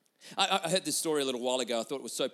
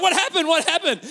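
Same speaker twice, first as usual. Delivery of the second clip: imitating a wife jolted awake, high and loud; mock-alarmed outburst; startled wife; 10 semitones higher, 9 dB louder than usual. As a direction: demand it in shrieky, comic bewilderment. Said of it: "she suddenly wakes up".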